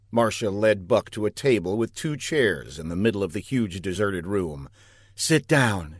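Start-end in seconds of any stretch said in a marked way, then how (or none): none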